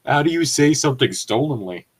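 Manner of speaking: ordinary talk, nothing marked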